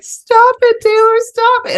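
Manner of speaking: very high, loud imitation of a distraught boy; screaming son. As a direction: shriek it like an upset big brother. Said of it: "my son was then screaming, crying".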